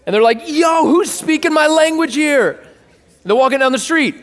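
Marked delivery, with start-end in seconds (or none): 0.5-2.6 high-pitched mock amazement; astonished passer-by. Passer-by hearing their own language from a window, astonished. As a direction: call it out high, as if startled in the street.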